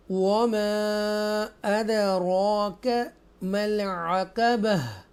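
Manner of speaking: slow, drawn-out tajweed chant; Quran recitation. Shown as a demonstration. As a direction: recite each word slowly and melodically.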